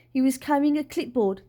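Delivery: usual speaking voice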